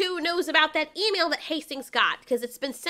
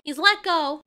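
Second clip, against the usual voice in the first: normal talking voice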